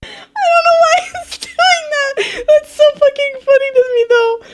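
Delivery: high, loud, helpless laughing squeal; laughing to tears, pitch way up; crying with laughter. Real, not acted. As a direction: squeal it through real laughter. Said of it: "Oh, I'm crying".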